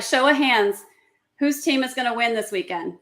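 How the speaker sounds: normal talking voice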